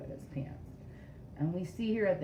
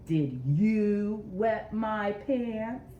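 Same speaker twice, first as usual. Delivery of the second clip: slow and a bit louder, YOU lifted; stress on YOU; 2 semitones higher, 4 dB louder, much slower than usual. Shown as a demonstration. Modeling the line again for kids, stressing you. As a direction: press hard on you, question rising. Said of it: "Can you try that?".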